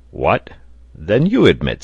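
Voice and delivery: loud, swooping disbelief; astonished cry. A narrator's reading. Reporter to Cyrus about the vanished ship, astonished cry. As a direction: challenge it in mild astonishment. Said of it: "cried the reporter".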